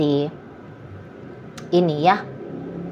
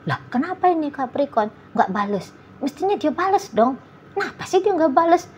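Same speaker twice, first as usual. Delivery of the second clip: rising, higher and more indignant; annoyed partner; 8 semitones higher than usual. Retold or imitated. Partner fuming at the Capricorn for not replying, in an indignant mock voice.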